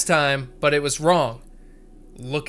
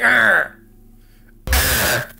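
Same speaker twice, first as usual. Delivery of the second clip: loud mock monster growl; hammed-up loud growl; scary growl; 7 dB louder than usual. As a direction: growl to scare them. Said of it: "wasn't that scary".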